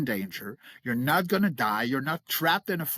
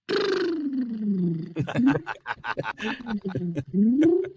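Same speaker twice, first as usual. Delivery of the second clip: long laugh; 4 semitones higher than usual. Real, not acted. Laughing at monster voices.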